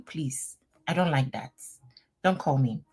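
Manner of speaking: ordinary delivery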